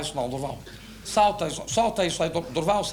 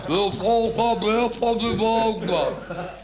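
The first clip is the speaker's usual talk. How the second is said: hammed-up booming, slow bass; drawn-out mock bass; deep voice; slower than usual. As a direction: put on a big grave voice, stretching every word. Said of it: "Agora uma vez de voz grave".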